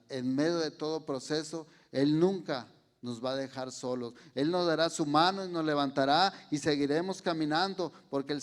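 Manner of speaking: loud, rising sermon climax; fervent preaching. Real, not acted. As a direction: preach it out with full conviction. Preaching at full force.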